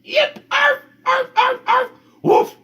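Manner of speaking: very high little dog barks; yipping barks. Acted. Martha trying to speak on the phone, high yipping barks. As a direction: bark like a small dog instead of talking. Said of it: "when she opened her mouth to speak".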